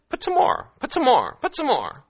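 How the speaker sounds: very high-pitched acted order; repeated mock command. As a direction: call it out high, dropping at each end. Elijah ordering more water, acted.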